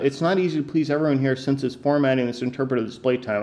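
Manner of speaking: fast, hurried read-through; rushed skim-read. Shown as a demonstration. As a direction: rattle it off to get past it. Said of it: "I'm not even gonna get into that".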